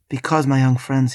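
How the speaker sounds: ordinary talk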